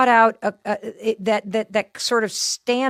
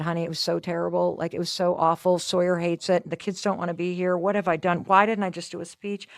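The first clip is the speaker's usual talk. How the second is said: softer, low, teary retelling to husband; half-imitating her own tearful call, subdued; retold crying; 6 semitones lower, 7 dB quieter than usual. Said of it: "and I started crying".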